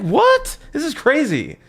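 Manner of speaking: shocked at the staff on their phones; retold disbelief. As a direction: jump way up in disbelief. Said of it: "I'm like".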